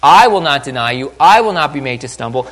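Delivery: loud, stubborn declaration; prideful Peter. Acted. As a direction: declare it forcefully, full of pride. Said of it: "His pride".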